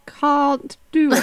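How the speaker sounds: high, slow posh British "Can't do it" with a long broad A in "Can't"; British accent. Shown as a demonstration. In British accent when asked by her friend, laughing. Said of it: "Say, say, "Can't do it" in British accent".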